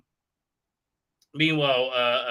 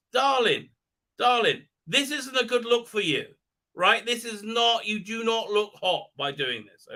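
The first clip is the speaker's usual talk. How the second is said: higher, campy darling voice; camp mocking; 7 semitones higher than usual. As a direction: scold him like a catty stylist. Mocking the character camply.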